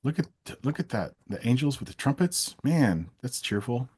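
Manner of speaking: normal talking voice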